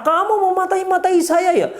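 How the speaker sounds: suara tinggi melengking meniru suami marah; suami marah menirukan. Retold or imitated. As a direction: tirukan suami yang balik menuduh dengan nada tinggi. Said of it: "Oh, begitu, marah-marah". (suami marah, nada tinggi)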